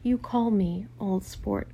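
slow, deliberate angry warning; drawn-out, indignant command; crying out. A narrator's reading. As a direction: space the words out in irritation. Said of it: "cried Tom".

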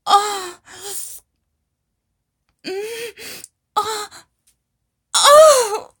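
high, breathless cries, swelling loud then easing; climactic moaning. Acted. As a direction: gasp and moan urgently through the words. Acted climax in intimate roleplay.

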